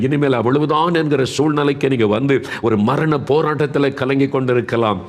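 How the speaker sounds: impassioned preacher addressing the suffering; fervent preaching. Acted. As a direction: press on urgently, phrase after phrase. Preaches to the sick in audience.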